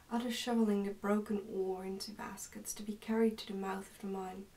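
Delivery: normal talking voice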